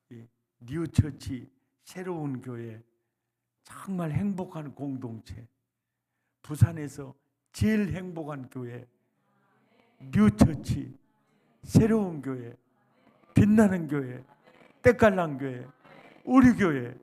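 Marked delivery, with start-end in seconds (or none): 6.4-17.0 louder and louder proud listing; rising proclamation. Acted. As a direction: build each phrase up with pride. Building proud church proclamation. (점점 고조되는 자랑스러운 외침)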